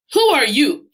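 high, loud surprised question; startled officer asking. Acted. Officer startled as Sam rises from the box, surprised. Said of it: "an officer asked in surprise".

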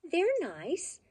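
put-on bright, lively storybook reading for kids; reading to children. A narrator's reading. Reading a favorite childhood book to children, lively storybook voice. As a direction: read it like a bedtime picture book, full of expression.

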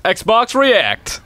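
high, punchy announcer voice; mock advert tagline. Player drops the brand into fake advert, announcer voice.

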